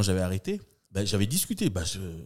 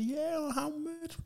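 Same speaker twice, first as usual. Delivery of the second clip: very high, slow tourist voice in English; imitating tourist; 8 dB quieter, much slower than usual. Australian tourist asking the price, high mock voice. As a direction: squeak it like a lost tourist. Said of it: "t'avais les Australiennes".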